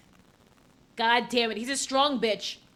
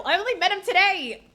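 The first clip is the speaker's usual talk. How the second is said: usual speaking voice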